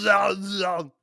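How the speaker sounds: put-on loud, high Austrian-accent no; shouted Austrian accent protest; Arnold accent shout.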